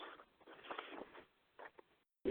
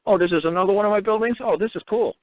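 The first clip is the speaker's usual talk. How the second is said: higher-pitched, casually delighted discovery; imagined breezy Queen; 8 semitones higher than usual. Acted. Queen casually filming her own building, lightly delighted. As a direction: lightly act a monarch pleased with her property. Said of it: "Of course she wouldn't ask".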